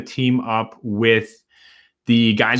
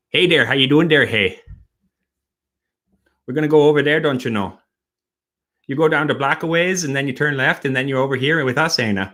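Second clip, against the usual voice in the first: friendly South Milwaukee neighbour giving directions; easygoing South Milwaukee accent; 4 semitones higher than usual. Shown as a demonstration.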